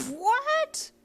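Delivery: high shrieked what; screeching. Acted. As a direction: shriek it in shock. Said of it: "D F screeched".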